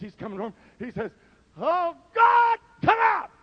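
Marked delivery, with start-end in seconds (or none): 1.6-3.3 high forceful exorcism cry; commanding shout. Acted. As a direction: command the demon out. [name] casting out demon.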